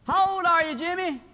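high, loud phone voice; loud telemarketer. Acted. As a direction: quiz a child loudly down the line. Telemarketer calling a whispering child.

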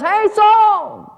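loud, high holler across a distance; calling out. Acted. David calling out to Saul, loud high holler. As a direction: holler to get his attention. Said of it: "David calls out and says".

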